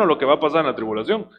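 usual speaking voice

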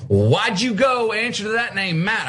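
lightly voiced scolding mother. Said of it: "My mother".

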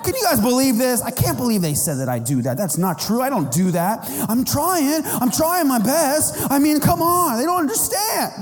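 hammed-up upset whining. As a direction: play the immature pastor in a mock meltdown. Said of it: "I'm like".

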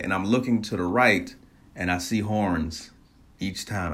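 usual speaking voice

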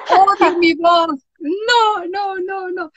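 a half-laughing, half-crying mock wail; retelling to the host her panic on camera, in a laughing mock wail; mock distressed whine. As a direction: whine it like you can't stand your own voice. Retold panic hearing own voice.

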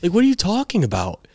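high, climbing indignant outburst; incredulous protest. Retold or imitated. Player retelling his argument with the coach, high and incredulous.

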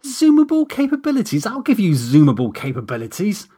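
mock his words back in anger; snapped. A narrator's reading. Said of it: "I snapped".

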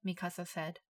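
plain reading voice

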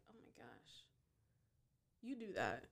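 hushed mock shock; breathy scandalized gasp, mocking; judgmental gasp. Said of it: "Don't be so quick to be like".

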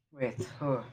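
winded, gasping for air; running out of air. Real, not acted. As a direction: caught short of breath. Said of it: "I am running out of breath".

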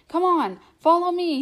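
high, loud call over the shoulder; hurried loud call behind him; calling back. A narrator's reading. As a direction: call back urgently to the others. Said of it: "he called back over his shoulder".

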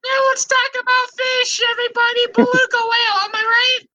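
silly squeaky fish lover; helium voice. Acted. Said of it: "it's the helium".

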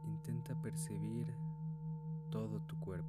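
calm, unhurried meditation guide; guided meditation. Acted. Leads a meditation. (voz de meditación guiada)

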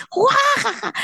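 high mocking guffaw; hammed-up cackle. Aunt laughing at the mother, high hammed-up cackle.

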